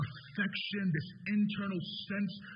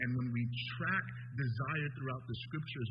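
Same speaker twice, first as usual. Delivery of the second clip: ordinary talk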